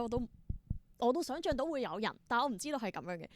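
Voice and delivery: usual speaking voice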